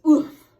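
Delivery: loud, sharp grunt of being winded; loud grunt of a man kicked hard; winded oof. A narrator's reading. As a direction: knock the air out of him. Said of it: "Little Bear let out a noise".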